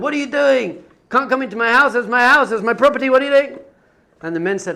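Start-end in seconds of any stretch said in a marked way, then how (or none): 0.0-3.6 high, raised-voice protest; retold outraged scream. Villager yelling at men emptying his house, outraged. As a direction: act out an indignant yell, pitched well up. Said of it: "screams at them".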